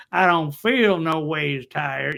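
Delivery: drawn-out, hammed-up Southern drawl imitation; slow mocking Southern preacher voice, laughing; mocking a politician's fake Southern accent. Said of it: "she put on that fake a".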